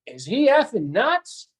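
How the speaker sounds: wife's mock disbelief, pitched high; retold slow, high-pitched incredulity; incredulous. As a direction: imitate a baffled wife, drawing it out slowly. Wife reacting in retold scene.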